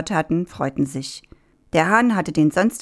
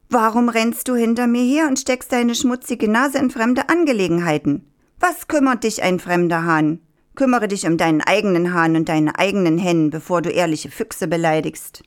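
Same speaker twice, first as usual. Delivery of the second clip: ordinary delivery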